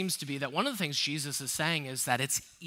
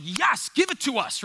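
higher and louder, eager; mock eager congregation; 7 semitones higher, 6 dB louder than usual. Congregation urging the preacher, mock eager cheer. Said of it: "most of us are like".

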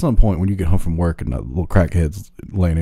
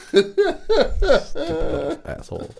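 laughing insult; much slower than usual. Real, not acted.

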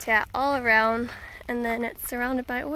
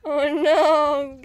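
laughing, louder amused exclamation; amused laugh at the dog; 2 semitones higher, 6 dB louder than usual. Real, not acted. Dog forgot to swim.

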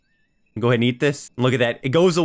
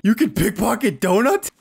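mock surprise; 3 semitones higher than usual. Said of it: "freaking out like".

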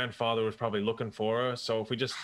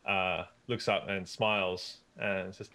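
ordinary delivery

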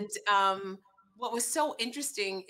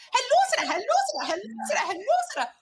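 high, loud happy greetings in turn; each relative gushing hello; eager relatives; 7 dB louder, faster than usual. Retold or imitated. Family members calling Sarah to welcome her, excited.

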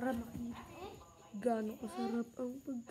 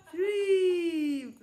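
excited shout; 6 semitones higher, 9 dB louder than usual. Real, not acted. Excited at the shrimp.

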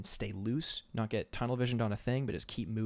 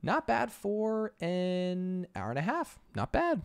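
smug; 9 semitones higher, slower than usual. Real, not acted. Proud self-praise.

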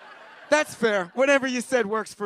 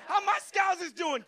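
mock shout; 6 semitones higher than usual. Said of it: "mock greeting crowd, shouted".

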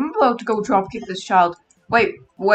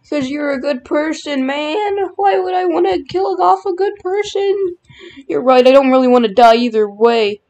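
ordinary talk, nothing marked

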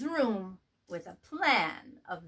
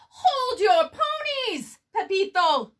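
calling out; 9 semitones higher, 5 dB louder than usual. A narrator's reading. Don Diego halting Pepito, high and loud. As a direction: stop them with a big call. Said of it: "spoke up".

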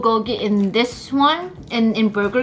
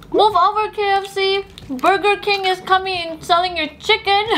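high, laughing mock challenge; excited boast; 9 semitones higher than usual. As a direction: announce it like a cheeky rival. Playful boast about the chicken.

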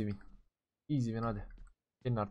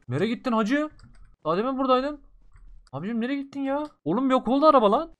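much higher, louder bewildered calls; higher loud stunned outcry; baffled excited; 11 semitones higher, 8 dB louder than usual. Real, not acted. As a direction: shout in disbelief at the vanished car. Enemy car vanished.

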